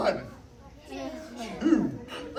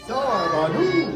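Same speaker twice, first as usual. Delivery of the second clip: drawn-out magic word, said louder; 3 semitones higher, 6 dB louder, slower than usual. Acted. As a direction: call out the spell for the kids to hear.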